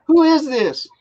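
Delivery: lightly voiced old lady. Old lady answering found phone.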